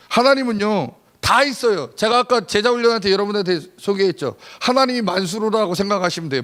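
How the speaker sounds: loud, high fervent sermon; full-throated preacher calling out to the pews; preaching at full force. Acted. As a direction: drive it home to the congregation.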